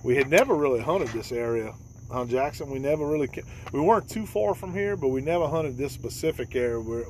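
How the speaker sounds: usual speaking voice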